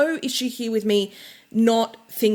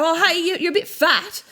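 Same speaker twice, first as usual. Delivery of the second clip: higher, cheery mock bluntness; mock blunt stranger; 7 semitones higher than usual. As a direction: play the tactless stranger, bright and casual. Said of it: "come up and go".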